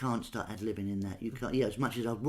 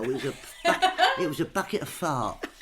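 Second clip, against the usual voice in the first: laughing; 3 semitones higher, 4 dB louder than usual. Real, not acted. Laughing while telling it.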